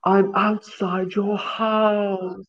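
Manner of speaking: high, very slow, creepy stalker voice; heavy breathing phone whisper. Pretend. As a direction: drag it out like a creepy caller.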